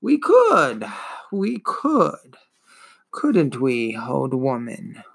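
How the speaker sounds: slow, dragging, wheezing ancient voice; wheezing old turtle. Acted. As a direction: drawl it out like a weary old beast. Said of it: "Morla grunted".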